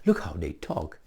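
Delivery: normal talking voice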